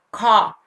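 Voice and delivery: "car" in British English with the final R silent, like "cah". Shown as a demonstration. Teacher showing Spanish learners a British "car" with a silent R. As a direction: say "car" with no R at the end. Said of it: "Si termina en R, no se pronuncia".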